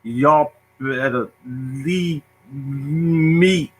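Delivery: very slow stammer with long blocks; heavy stutter. Shown as a demonstration. Young self pleading with bullies, heavy drawn-out stutter. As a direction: drag each sound out, straining towards the next word. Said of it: "I was like".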